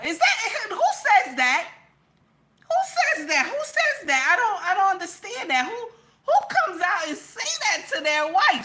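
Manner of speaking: high, loud mock-baffled outburst; stammering, incredulous squawk of surprise; surprised. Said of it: "I was surprised".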